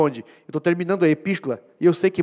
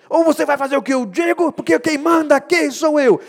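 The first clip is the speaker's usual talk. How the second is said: loud, high domineering shout; mock yelled ultimatum from a leader who wins by shouting; bossy leader yelling; 10 semitones higher, 8 dB louder than usual. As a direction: bark orders like a tyrant boss. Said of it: "não é aquela que ganha no grito".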